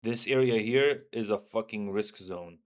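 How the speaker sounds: ordinary talk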